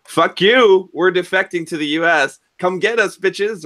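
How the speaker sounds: mock-defiant high yell to the enemy; defiant captain's message. Retelling the captain taunting Moscow, mock-defiant high yell. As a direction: fling it at Moscow like a dare.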